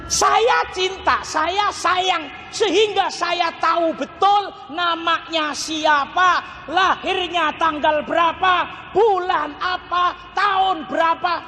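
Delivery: high, slow emphatic preaching; impassioned oratory. Acted. Preacher recalling his courting days to the congregation, impassioned. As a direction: declaim each item high and drawn out. Impassioned rhetorical build in sermon.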